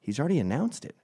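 ordinary talk, nothing marked

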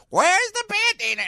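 very high, slow squawk; Clara Peller flub. Retold or imitated. Someone replays the old clip, high slow squawk. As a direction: draw it out high like a dotty old lady. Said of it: "and says".

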